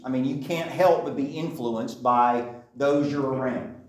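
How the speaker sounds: ordinary delivery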